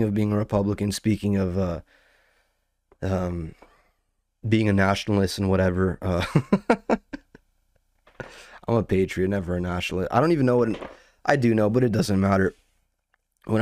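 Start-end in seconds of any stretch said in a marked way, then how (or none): 6.3-7.1 laugh. Real, not acted. Laughing at own remark.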